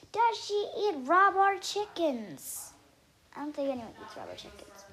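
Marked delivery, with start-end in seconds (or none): 0.1-2.7 loud, high, slow final question; insistent questioning. A narrator's reading. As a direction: belt out the absurd question, stretched out. Climax of pestering questions.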